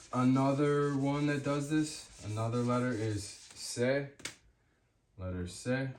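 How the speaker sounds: ordinary delivery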